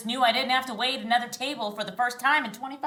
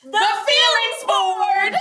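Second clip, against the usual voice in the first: high, loud, slow showman announcement; grand reveal; 9 semitones higher, 10 dB louder, much slower than usual. Acted. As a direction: present it like a game show prize.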